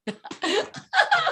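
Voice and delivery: a high flirty giggle; coquettish high laugh. Acted. Wife teasing the flustered clerk with a high giggle.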